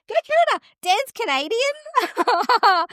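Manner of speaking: high, delighted squeal; giddy, high-pitched rooting for the home side; excited cheering. Real, not acted. Cheering co-host's home country.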